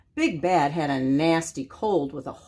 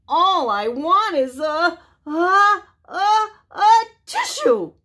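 rising higher and louder to a sneeze; wolf about to sneeze; 10 semitones higher, 6 dB louder than usual. Acted. Sick wolf begs for a tissue, rising to a sneeze. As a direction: build the sneeze on each a.

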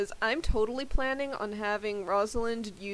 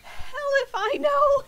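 high put-on voice; 6 dB louder, slower than usual. Character answers in voice.

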